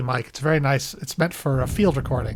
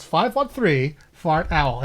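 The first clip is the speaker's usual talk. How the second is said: slow, measured number; slow number read; much slower than usual. Shown as a demonstration. Host repeating the number for listeners. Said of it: "there's your mnemonic".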